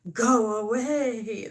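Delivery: slow, drawn-out "Go away"; mock grumpy dismissal. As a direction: grumble it away, dragging the words. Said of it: "That's how I feel".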